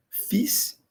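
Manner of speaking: fils said as fis, silent l, high pitch; French fils. Shown as a demonstration. Teacher corrects herself, models fils for students. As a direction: say fils without the l. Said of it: "Olha lá, como F I S".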